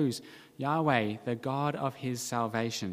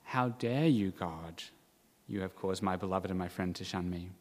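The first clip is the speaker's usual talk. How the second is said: low, hushed reproach of God; understated accusation; 7 semitones lower, 6 dB quieter than usual. Acted. What the psalmist does not say to God, understated accusation. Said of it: "But the psalmist does not say".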